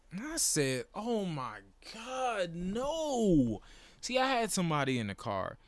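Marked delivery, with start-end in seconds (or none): 1.0-3.6 high, drawn-out wail of disbelief; retold anguish. Fan hearing the walk-off homer, anguished. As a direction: stretch each word in mock agony. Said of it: "had to hide my reaction".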